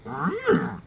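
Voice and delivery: high-pitched creature hum; Yoda. Acted. Host tries the wrong character, in a high Yoda hum. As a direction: hum high like a little alien sage. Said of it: "Oh, I did Yoda".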